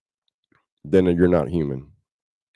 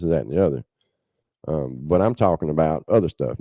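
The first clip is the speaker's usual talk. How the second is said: usual speaking voice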